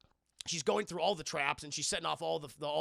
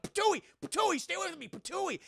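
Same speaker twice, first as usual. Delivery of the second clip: high, loud mock spitting noises; hammed-up patooey spitting; panicked spitting; 12 semitones higher, 4 dB louder than usual. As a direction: spit it out like a freaked-out cop.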